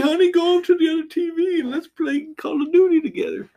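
high mocking sweetheart voice; mock a lovey-dovey girlfriend; mocking girly voice. Mocking friend's girlfriend talk.

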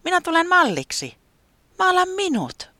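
high thrilled weatherman offering to pose; excited. Acted. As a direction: shout it like an eager volunteer. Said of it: "säämies innostui".

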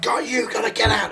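mild high-pitched mock scream; half-hearted urgent holler; retold scream. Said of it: "They're screaming at us".

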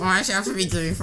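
whiny nerd troll voice. Retold or imitated. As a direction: echo the whiny nerd impression. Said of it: "I love your nerd voice".

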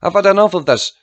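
high, loud exasperated outburst; screaming. A narrator's reading. Ma breaks up the family fight, screaming. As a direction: shout it out, fed up. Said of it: "Ma was screaming".

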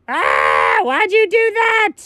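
high startled yelp; startled shout. Acted. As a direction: jump and yelp in surprise. Startled after being scared.

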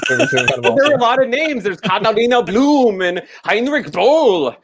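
high, drawn-out protest with exaggerated German names; hammed-up German accent. Teased co-host defends his German name pronunciation, hammed-up German accent. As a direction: roll out the names in a big mock German accent. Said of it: "say the names in that German accent you got".

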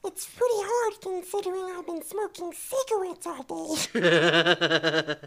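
very high puppet falsetto, loud at first; Elmo. Acted. Puppet voice done for his kid, high falsetto. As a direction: squeeze it from the back of the throat. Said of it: "it's probably Elmo".

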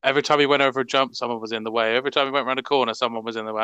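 ordinary talk

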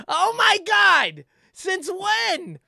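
high, slow fake astonishment; mock shock. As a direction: gasp it out in fake disbelief.

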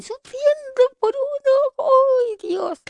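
hammed-up lovesick wail, high and slow; mock lovesick. Teen on the train pining over a boy, hammed-up lament.

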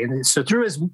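ordinary talk

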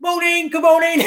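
high, laughing good morning; cheery village greeting. Retold or imitated. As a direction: chirp it like friendly villagers. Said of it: "was saying".

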